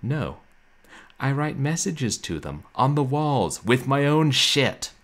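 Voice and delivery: loud, high retort, building in force; imitating sarcastic streamer. Streamer answers a viewer's question, mock loud sarcastic retort. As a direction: deliver the punchline as a big mock outburst. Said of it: "And Trasha said".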